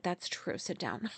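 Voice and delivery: very soft, subdued retold reply; quiet concession. Giving in to the friend at the vet, quiet concession. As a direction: give in under your breath.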